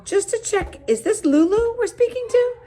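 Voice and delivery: high, loud, incredulous check; can this really be her?; disbelieving. Acted. Mom checking who's on the phone, disbelieving.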